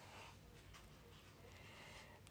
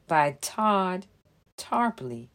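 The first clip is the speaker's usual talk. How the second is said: ordinary delivery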